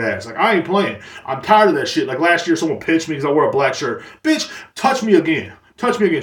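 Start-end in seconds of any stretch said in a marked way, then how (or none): 4.2-6.2 higher, half-playful warning; mock threat. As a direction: dare them to try it again.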